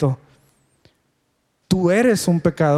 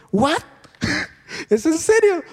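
much higher, incredulous with a laugh; disbelieving surprise; 9 semitones higher than usual. Retold or imitated. Recalling his reaction to her Power Rangers question, surprised. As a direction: pitch jumps up in amused disbelief.

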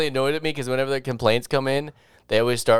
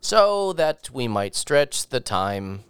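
very slow, drawn-out words; mock slow talk; 2 semitones higher, much slower than usual. Shown as a demonstration. Mocking his friend's pace, slow and drawn out. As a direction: drag every word out slowly. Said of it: "started talking like this".

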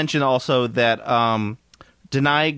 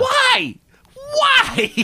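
high, laughing outcry of disbelief; real laughing exasperation; laughing shout; 4 dB louder than usual. Real, not acted. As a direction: throw up a laughing "why" much higher. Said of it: "laughing exasperated outburst".

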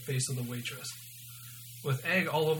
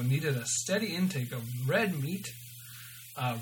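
ordinary delivery

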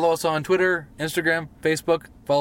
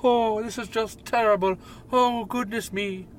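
high, slow British butler wail; hammed-up British lament, pitched well above usual; mock British butler; 7 semitones higher than usual. Wrestler mocking shaved rival's lost identity, mock British butler. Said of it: "I don't know why he became a British butler".

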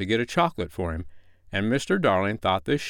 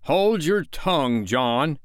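high, slow stern order; sharp rebuke; 6 semitones higher, 4 dB louder, slower than usual. A narrator's reading. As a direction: snap it out at the boy. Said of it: "his father rapped out".

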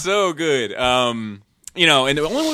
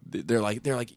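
ordinary talk

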